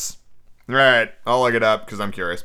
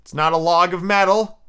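louder, higher, punching each material; exasperated rant; 5 semitones higher, 4 dB louder than usual. Real, not acted. Reader objecting to "log of wood", exasperated. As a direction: rant loudly over needless wording. Reader rants about redundant wording.

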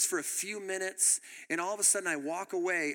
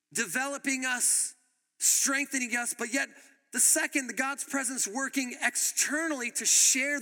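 higher, insistent preaching voice; emphatic preaching; 6 semitones higher than usual. Real, not acted. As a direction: preach each point with raised pitch.

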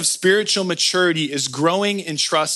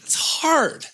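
whiny sulk; 9 semitones higher, 5 dB quieter than usual. Retold or imitated. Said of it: "And I was like".

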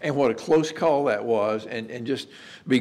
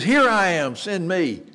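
higher, emboldened declaration with an exclamation point; bold, eager volunteering; 4 semitones higher, slower than usual. Acted. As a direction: step right up and volunteer eagerly. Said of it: "so moved and so emboldened".

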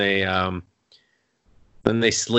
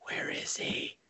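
hushed unvoiced worry; whisper; 16 dB quieter, slower than usual. Limo driver fretting alone outside the academy. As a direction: whisper it to yourself anxiously.

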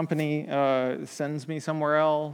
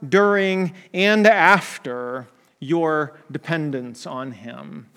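emphatic preaching; 7 dB louder, slower than usual. Acted. Preacher proclaiming God's grace to the congregation, slow and emphatic. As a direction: build it up like the sermon's peak.

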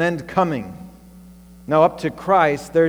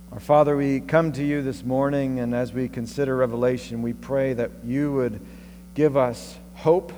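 prayer; 5 dB quieter than usual. Acted. Closing prayer.

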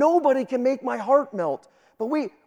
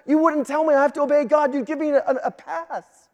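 higher-pitched, piled-up protest; indignant excuse-making; 4 semitones higher than usual. Acted. Preacher voices a counselee protesting to the counselor, indignant excuse-making. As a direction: plead your special case, insistently, pitched up.

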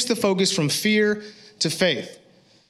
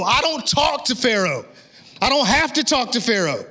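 amped up preaching; 6 semitones higher than usual. Real, not acted. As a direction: preach it hot, voice climbing. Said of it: "I'm really amped right now".

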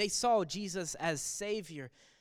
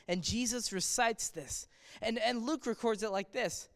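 ordinary talk

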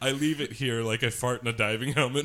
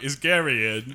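put-on pub-phone British accent, a bit louder; mock British accent; 2 semitones higher, 5 dB louder than usual. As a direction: call for Gary like a London local. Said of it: "And it was like".

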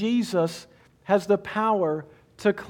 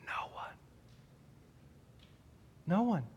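hushed answer; 2 semitones lower, 14 dB quieter than usual. Real, not acted. Preacher answering his own question.

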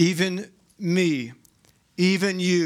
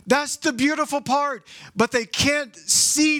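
higher, building joy over the beautiful part; preacher's rising excitement; rising preaching fervor; 8 semitones higher than usual. Real, not acted. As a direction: lift it up, marvel at it. Preacher lifting to the climax.

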